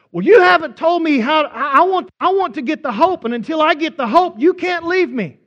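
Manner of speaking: loud, high, mock-tearful pleading; desperate plea. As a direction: beg them not to leave. Grieving man pleads not to go.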